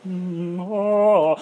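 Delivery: droning, chant-like mock pastor; robed church pastor. Mocking robed pastors at church, solemn drone. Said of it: "the pastors are wearing, like, the long robes".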